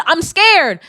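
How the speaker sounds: high, loud, mock-frightened "I'm scared". Meme man admitting he is scared, mock-frightened high voice. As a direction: whimper it like a spooked man. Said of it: "And he said".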